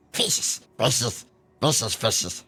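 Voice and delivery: lightly done creature voice; Smeagol impression. Streamer does a quick Smeagol bit for chat, lightly voiced creature. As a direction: hiss the words like Gollum over fish.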